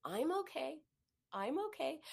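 tender self-comfort; gentle self-reassurance. Shown as a demonstration. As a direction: soothe yourself quietly. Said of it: "Or better yet".

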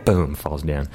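ordinary delivery

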